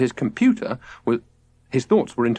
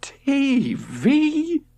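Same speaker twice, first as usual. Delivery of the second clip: shocked, much higher; appalled. A narrator's reading. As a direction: recoil at the very idea. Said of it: "George was appalled".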